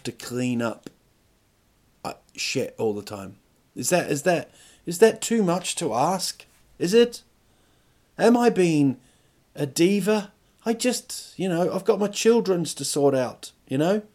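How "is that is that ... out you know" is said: high-pitched comic pleading; aggrieved, raised-pitch self-justifying; mock plaintive. Real, not acted. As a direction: protest like a put-upon diva. Mock-pleading, asks if being a diva.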